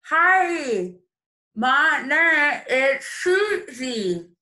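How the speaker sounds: garbled, slow words through toothpaste; mouth full of toothpaste. Acted. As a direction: talk while brushing teeth. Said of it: "with her mouth full of toothpaste".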